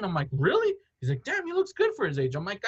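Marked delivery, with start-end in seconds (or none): 0.3-0.7 high surprised really. Retold or imitated. Girlfriend's disbelieving reply. 1.2-2.3 put-on impressed girlfriend. Girlfriend admiring his looks.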